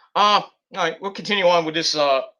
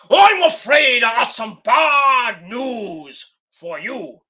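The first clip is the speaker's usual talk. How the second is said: slow, loud, higher put-on voice delivering bad news; Stu's grave voice; 5 semitones higher, 7 dB louder, much slower than usual. Playing Stu announcing bad news to the host, slow and loud. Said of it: "You got any bad news?".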